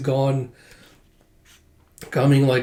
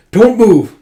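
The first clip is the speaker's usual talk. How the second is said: high, very loud command; threatening shout; 8 semitones higher, 11 dB louder than usual. Acted. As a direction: shout an order to freeze. Soldier threatens with sword.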